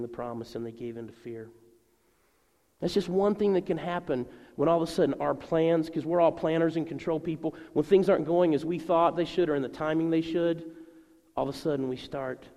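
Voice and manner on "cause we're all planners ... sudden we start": ordinary talk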